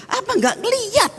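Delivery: high, incredulous "didn't you see?"; indignant question. Retold or imitated. Preacher voicing her own irritation at the security guard, high and incredulous. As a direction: squeak up in disbelief at the guard. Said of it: "mata saya bilang".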